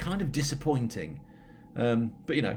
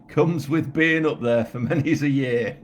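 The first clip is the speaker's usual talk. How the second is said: loud, playful Yorkshire voice; hammed-up Yorkshire, raised and laughing; Yorkshire accent; 4 semitones higher, 8 dB louder than usual. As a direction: lay the Yorkshire on thick, with a chuckle. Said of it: "You're not bad at a Yorkshire accent".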